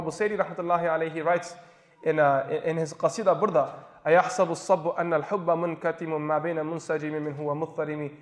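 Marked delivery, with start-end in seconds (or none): 4.1-8.1 quick, even recital of Arabic verse; Arabic poem recitation. Acted. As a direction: recite the verse in a level, measured line. Recites a line of Arabic poetry.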